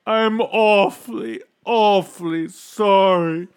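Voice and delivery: loud, high, slow tearful wail; hammed-up crying apology, words drawn out; sobbing king. A narrator's reading. Old king begs Bartholomew back, crying out remorsefully. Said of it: "The old king was crying".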